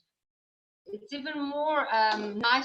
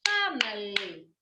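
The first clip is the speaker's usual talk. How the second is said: family said slowly, each syllable distinct; syllable demo; 3 semitones lower than usual. Shown as a demonstration. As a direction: model the word for the class. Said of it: "Let's do".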